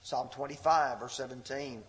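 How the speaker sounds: normal talking voice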